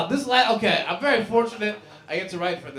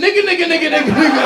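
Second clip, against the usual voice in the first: loud high rapid-fire taunt; defiant taunt; 7 semitones higher, 8 dB louder, faster than usual. Retold or imitated. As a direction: fire the words back at her defiantly. Defiant retort to coworker.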